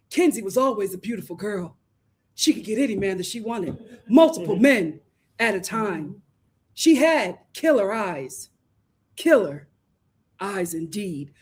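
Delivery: a hint of a low, ominous crime-show narrator; true crime voiceover. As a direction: narrate it like a murder documentary, lightly. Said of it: "I can hear the voiceover in my head".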